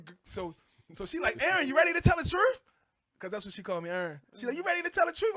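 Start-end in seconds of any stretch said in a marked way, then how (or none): 1.4-2.6 higher, louder pointed question; mock stern wife. Wife confronting husband about his past, higher pointed voice. 4.6-5.3 higher, louder pointed question; mock stern wife. Wife confronting husband about his past, higher pointed voice.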